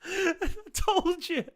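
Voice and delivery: loud, high, helpless laughing speech; manic laughter. Real, not acted. Fan crowing over a goal, manically laughing. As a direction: crack up while getting the words out. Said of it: "laughing manically".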